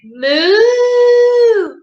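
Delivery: high loud cow moo. Acted. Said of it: "Cat went".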